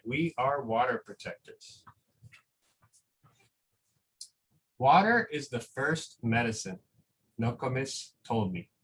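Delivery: slow, gentle storybook reading with long pauses; reading a picture book aloud. Acted. Teacher reading a picture book to school families, slow and gentle.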